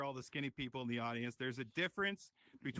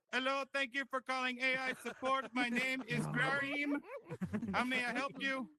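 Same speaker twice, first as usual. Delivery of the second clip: support agent voice; 9 semitones higher, 4 dB louder than usual. Pretend. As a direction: play a too-polite helpline agent.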